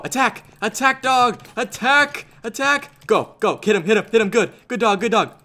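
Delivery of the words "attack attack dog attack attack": high, urgent attack order; urgent command. Real, not acted. Player sending his dog into a deathmatch, urgent. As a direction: urge the dog into the fight.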